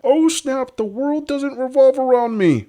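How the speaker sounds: very high, loud falsetto parody; mocking woman impression. Host mocking a self-pitying single mom, falsetto. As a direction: mimic her in a squeaky-high, loud voice.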